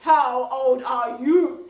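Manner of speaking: put-on low, slow, loud growl of an old woman; deep growly voice. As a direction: throw the question back menacingly. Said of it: "this deep, growly voice said".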